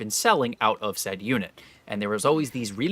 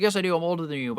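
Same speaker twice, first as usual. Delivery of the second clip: mock older guy; 7 semitones higher, faster than usual. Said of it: "He's like".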